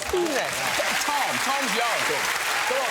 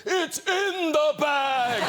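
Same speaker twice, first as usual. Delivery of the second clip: slow, high, booming shout; 9 semitones higher, 4 dB louder, much slower than usual. Retold or imitated. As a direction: boom it out like a wizard. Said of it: "you just hear this booming voice going".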